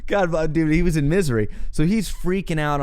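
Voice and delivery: normal talking voice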